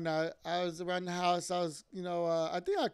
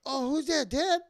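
put-on higher, eager questioning as a little boy; excited child asking; 6 semitones higher, 4 dB louder than usual. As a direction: imitate a son's hopeful surprise, pitch lifting. Acting his young son at the door.

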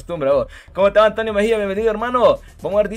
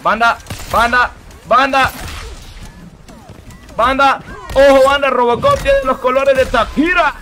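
loud, higher, hyped calling; excited calling out; 5 semitones higher, 5 dB louder than usual. Real, not acted. Streamer hyping his viewers over the game. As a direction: shout to the crowd, all fired up.

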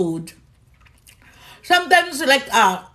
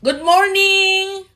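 higher, louder, slow drawn-out good morning; sing-song greeting; 7 semitones higher, 4 dB louder, slower than usual. Real, not acted. Host greeting Mary on the live, slow and sing-song. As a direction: stretch the morning out sweetly.

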